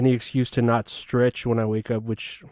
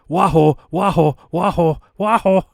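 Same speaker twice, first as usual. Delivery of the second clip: repeated game catchphrase, climbing higher; excited Wario; 5 semitones higher, 3 dB louder than usual. Retold or imitated. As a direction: spam it like a sound button, rising as you go. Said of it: "getting closer and closer to someone".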